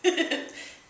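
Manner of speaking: short unvoiced laugh through tears; a quick, breathy tearful laugh. Real, not acted. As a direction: laugh it off, embarrassed. Tearful self-conscious moment.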